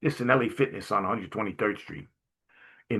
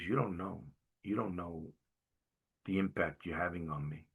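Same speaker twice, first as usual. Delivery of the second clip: much softer, "don't know" said twice; softly moved; 4 semitones lower, 10 dB quieter than usual. Retold or imitated. Mother in Costco telling the rabbi his impact, soft and moved. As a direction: hush it like a grateful confession. Moved woman thanks him.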